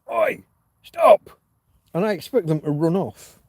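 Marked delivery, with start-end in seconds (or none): 0.1-1.4 high, loud shout to break up a fight; retold shout. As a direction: shout it sharply down an alleyway. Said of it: "and I shout".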